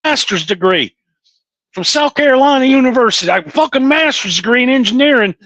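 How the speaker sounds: high-pitched, aggrieved Southern twang; Southern accent. Retold or imitated. Coworker griping that nobody will hire him, in an imitated Southern accent. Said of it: "It's 'cause I talk like this".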